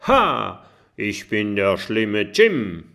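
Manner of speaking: hammed-up pirate bragging, slowly; playful pirate call. A narrator's reading. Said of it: "schwingt sein Holzschwert und ruft". (spielerischer Piratenruf, lauter)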